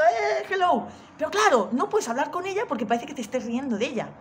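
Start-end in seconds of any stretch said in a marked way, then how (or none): 0.0-0.9 vecina loca saludando. Retold or imitated.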